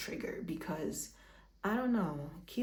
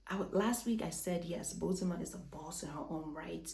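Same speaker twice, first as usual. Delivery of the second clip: ordinary talk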